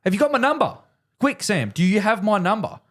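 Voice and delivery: high, strained urgent plea; urgent mate. Retold or imitated. Golfer pleading with his mate for his number. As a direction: push it up high and hurried. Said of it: "Jay goes".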